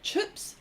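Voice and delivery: "chips" said as "chups"; "chips" attempted as "chups"; Kiwi vowel. Shown as a demonstration. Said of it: "I can't even say it properly".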